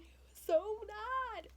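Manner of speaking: slow, high, broken sob; retold tearful whimper; sobbing lament. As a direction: whimper it through tears. Sitting there sobbing.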